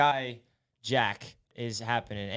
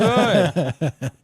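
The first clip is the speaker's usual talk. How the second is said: louder, laughing repeat of the caller's words; laughing echo; 4 semitones higher, 8 dB louder than usual. Real, not acted. Host laughs back at the caller's "no good", amused echo. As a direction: crack up while echoing him. Echoing caller's gloomy opener.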